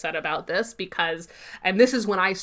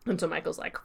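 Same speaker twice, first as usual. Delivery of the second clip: ordinary talk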